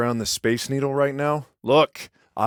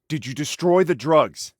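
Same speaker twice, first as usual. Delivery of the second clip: ordinary talk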